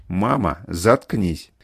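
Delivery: low, firm command; stern order. A narrator's reading. Элис ordering her mother to shut up, low stern command. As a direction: drop the pitch and order it. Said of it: "приказала Элис".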